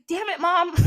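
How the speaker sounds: high-pitched exasperation, half laughing; mock-mad son. Horus scolding his rescuing mother, mock mad. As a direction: curse at mom in comic frustration. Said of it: "So he's super mad".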